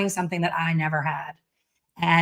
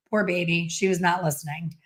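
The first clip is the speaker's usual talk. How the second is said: ordinary talk, nothing marked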